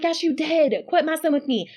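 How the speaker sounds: high, exasperated; husband insisting. Retold or imitated. Husband scolding his wife, high and exasperated. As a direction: scold her for the prank.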